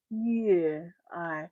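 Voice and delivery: soft, understated reply; imitated cool rapper. Rapper answering screaming fans, imitated low-key cool. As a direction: answer quietly, dropping low. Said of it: "then he's like".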